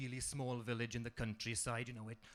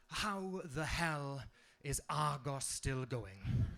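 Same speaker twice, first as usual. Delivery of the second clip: slow big question; much slower than usual. Retold or imitated. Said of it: "big questions like".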